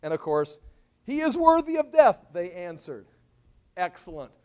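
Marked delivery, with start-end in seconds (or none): 1.0-2.2 loud, high pronouncement; condemning verdict. Acted. Sanhedrin answering the high priest, condemning shout. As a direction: declare the sentence forcefully.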